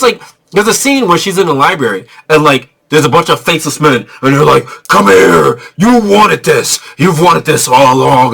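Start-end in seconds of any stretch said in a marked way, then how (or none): 4.9-8.3 creepy men voice. Retold or imitated.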